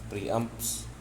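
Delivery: normal talking voice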